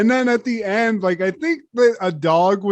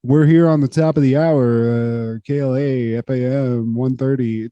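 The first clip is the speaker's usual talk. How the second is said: low radio announcer drone; radio host; 6 semitones lower than usual. Retold or imitated. Comedian aping a radio sign-off, low announcer voice. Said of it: "just like".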